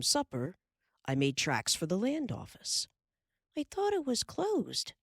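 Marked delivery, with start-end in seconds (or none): 3.6-4.9 Carrie's voice. A narrator's reading. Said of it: "said Carrie".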